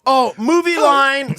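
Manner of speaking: slow, high, drawn-out announcement; excited call-out. Retold or imitated. Retelling the movie's mooners spotting a line, calling out. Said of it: "they, they go".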